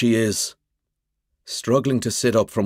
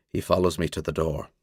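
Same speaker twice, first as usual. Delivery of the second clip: ordinary delivery, nothing marked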